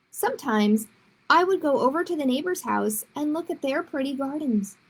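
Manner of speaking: ordinary delivery, nothing marked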